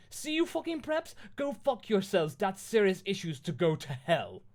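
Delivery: high, loud angry outburst; petulant, shouted tirade; ranting fanfic author. Acted. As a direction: spit insults at the readers, starting very high. Reads the author's note in voice.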